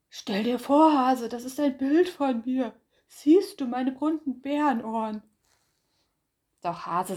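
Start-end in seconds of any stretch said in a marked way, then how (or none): 0.1-5.2 bright, loud boasting bear, pitched up; proud bear voice. Acted. Bär boasts to Hase about his picture, loud and proud.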